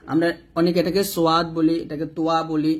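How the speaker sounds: normal talking voice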